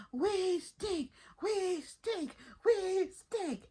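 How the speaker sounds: high sing-song cheer; mock cheering. Fans cheering about stinking, high chant. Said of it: "y'all be here cheering".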